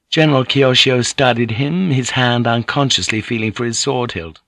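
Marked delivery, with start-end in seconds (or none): none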